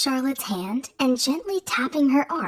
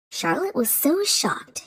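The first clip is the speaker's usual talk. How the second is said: usual speaking voice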